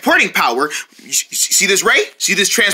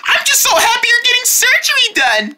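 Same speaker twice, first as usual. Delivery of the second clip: much higher, gushing and eager; high excited voice; 9 semitones higher than usual. Acted. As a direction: squeal it out with excitement.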